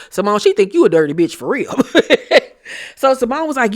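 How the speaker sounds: normal talking voice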